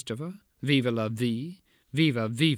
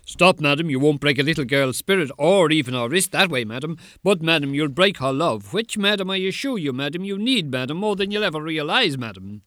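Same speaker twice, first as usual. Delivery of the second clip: put-on child protector's voice; 3 semitones higher, 5 dB louder, faster than usual. Poet imagines confronting the mother, loud and firm. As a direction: stand up to her, voice raised and driving. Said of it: "in my special child protector's voice".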